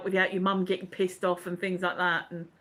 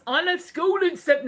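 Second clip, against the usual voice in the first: higher-pitched put-on voice that misses; botched listener impression; 7 semitones higher, 4 dB louder than usual. Host reading Jimmy's message, botched higher impression. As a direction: attempt a character voice, pitched up. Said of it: "That's not the voice".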